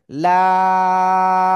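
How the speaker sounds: লাম held very long, loud and even; long laam. Shown as a demonstration. Teacher leading class to recite, loud drawn-out laam. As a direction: draw out লাম for the class to copy. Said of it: "সবাই লাম অক্ষরটা উচ্চারণ করতে হবে".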